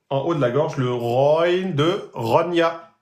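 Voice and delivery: usual speaking voice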